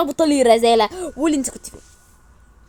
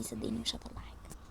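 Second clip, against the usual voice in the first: hushed, breathy vow; whispered promise; 20 dB quieter than usual. Acted. Friend whispers a promise to keep the secret. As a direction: swear it in a whisper.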